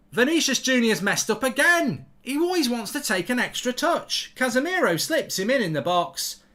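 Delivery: loud, high-pitched football rant; exasperated live commentary. Real, not acted. Streamer reacting to a wasted chance, loud exasperated commentary. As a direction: complain loudly as the chance is wasted.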